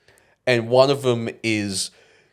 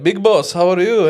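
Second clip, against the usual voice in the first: a louder, higher greeting from the shop clerk; store clerk greeting; 8 semitones higher, 6 dB louder than usual. Retold or imitated. Convenience store clerk greets a regular, loud and cheerful. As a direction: boom it out cheerfully like the man at the counter. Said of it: "So he'd be like".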